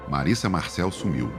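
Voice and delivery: ordinary talk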